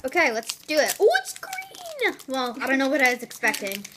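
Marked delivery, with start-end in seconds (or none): 1.0-2.2 surprised. Real, not acted. Opening the wasabi Kit Kat.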